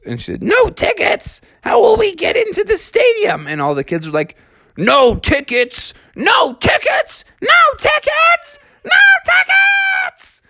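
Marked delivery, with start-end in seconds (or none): 0.4-3.5 very high, incredulous character voice; high-pitched dismay. A narrator's reading. Family learns there are no tickets, high dismayed voice. 4.8-10.4 put-on high, drawn-out shrieking kids; kids wigging out. A narrator's reading. Bear cubs panic over missing tickets, escalating high shrieks. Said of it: "all the kids were wigging out".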